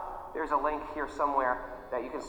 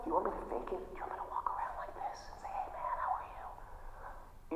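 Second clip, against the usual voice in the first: fake yoga teacher voice; 2 semitones higher, 11 dB quieter than usual. Shown as a demonstration. Mocking fake yoga teachers to his class, a hushed put-on whisper. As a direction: play the too-calm, breathy-sweet yoga clone. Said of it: "a fake yoga clone".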